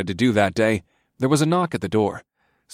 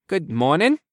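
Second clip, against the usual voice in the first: higher, startled hello; startled greeting; 7 semitones higher, slower than usual. A narrator's reading. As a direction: caught off guard greeting. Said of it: "said with a start".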